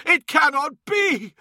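very high cry, pleading in disbelief; anguished, betrayed. A narrator's reading. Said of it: "crushed by the gut-wrenching hurt of betrayal".

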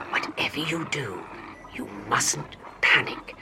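put-on calm the boy without stirring the tiger; doctor whispering firm orders to the trapped boy; authoritative whisper. A narrator's reading. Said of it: "His voice was barely a whisper".

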